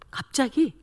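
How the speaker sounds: ordinary talk, nothing marked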